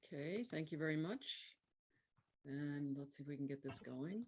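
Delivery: faint and far from the mic; barely audible. Real, not acted. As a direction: voice fading, too quiet to hear. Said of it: "your voice is very low".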